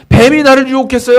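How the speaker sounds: high, loud indignant excuse; Eve blaming. Retold or imitated. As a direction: shift the blame with a pout. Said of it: "뱀을 탓했어요".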